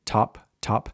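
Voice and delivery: tongue behind the teeth, puff on top; airy English t in top. Shown as a demonstration. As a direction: top with an aspirated t. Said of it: "start with the English sound".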